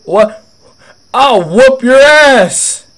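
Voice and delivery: loud, high, slow furious yell imitating the old man; angry old customer. Old customer yelling at the worker who insulted him, loud high furious yell. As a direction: bellow it like an outraged old guy threatening.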